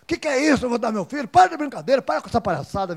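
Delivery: loud, high indignant outburst, retold; angry retort. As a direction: bristle like an insulted prisoner. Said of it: "Ele disse que ficou bravo".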